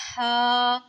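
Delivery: हा with breath pushed out; breathy haa. Shown as a demonstration. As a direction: breathe out through हा. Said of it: "जो हम सांस लेते हैं". (सांस के साथ हा)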